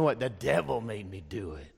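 mock comic excuse, dropping low; lightly imitating Flip Wilson's catchphrase; Flip Wilson quote. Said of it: "Flip Wilson used to go around saying".

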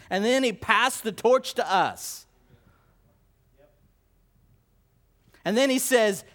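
loud, high, driving sermon voice; fiery preaching. Acted. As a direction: preach it out loud to the whole church. Preaching at full force.

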